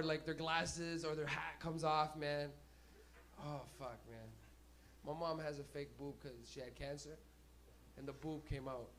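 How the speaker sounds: usual speaking voice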